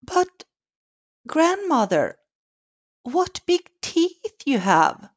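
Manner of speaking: put-on high child's voice, a bit uneasy; a nervous child, pitched high; little girl voice. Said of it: "her voice queerly slightly".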